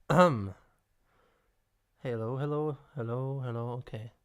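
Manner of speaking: normal talking voice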